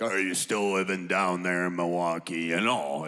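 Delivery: snarly, very deep throaty drone; low didgeridoo-like mumble, a little snarly; Uncle Jack. Retold or imitated. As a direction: imitate a grumbling uncle far below your own pitch. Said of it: "he can get a little snarly".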